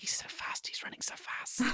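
breathless whisper of admiration; hushed starstruck whisper; whispered awe. Retold or imitated. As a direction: whisper it like a smitten fan. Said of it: "she's like".

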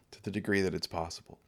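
an afterthought under the breath; soft quick echo. Acted. Repeated phrase, echoed softer.

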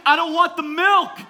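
high, loud protesting kid imitation; picky child. Daughter refusing milk to dad, picky child. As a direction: wail it like a stubborn child.

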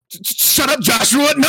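high, loud stammering shout; stammering mock yell of denial; flustered shout. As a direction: sputter it out, caught red-handed. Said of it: "he was just like".

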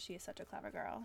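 hushed breathy whisper of the movie line; whispered quote. Pretend. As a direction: whisper it like a secret. Quoting the movie's famous line.

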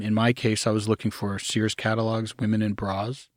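ordinary talk, nothing marked

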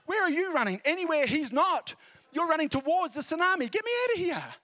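high, loud mock panic. Beachgoer fleeing the sunburned Scot, loud panicked. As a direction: play the baffled beachgoer fleeing.

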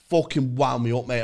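usual speaking voice

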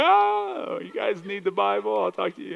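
high, squeaky, nervous brush-off; flustered pastor. Retold or imitated. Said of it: "I'm like".

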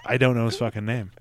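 ordinary delivery

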